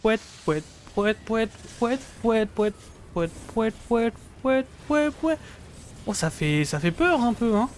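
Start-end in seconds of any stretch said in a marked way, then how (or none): 0.0-5.4 high, bouncy clown honks; goofy sing-song pouet; silly clown. Pretend. Cheering the evolution on.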